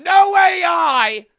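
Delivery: slow, high, all-out yell; full-volume stretched shout. Acted. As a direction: bellow it as a proud declaration. Shouting about the hand-drawn design.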